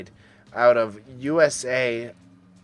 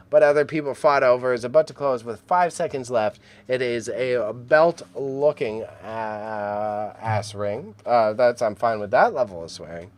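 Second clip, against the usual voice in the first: usual speaking voice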